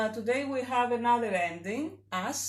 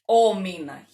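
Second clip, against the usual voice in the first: "ο" leaned on loudly before μήνα; Old Greek form; 2 semitones lower, 8 dB louder than usual. Shown as a demonstration. Teacher citing the Old Greek vocative, loud emphasis on "ο". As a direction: hit "ο" hard, then let "μήνα" fall away. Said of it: "we would say".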